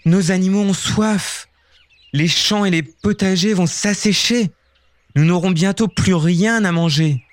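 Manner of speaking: high, hurried panic; panicked villagers. Acted. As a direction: pitch up in growing alarm. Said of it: "Les villageois s'affolent".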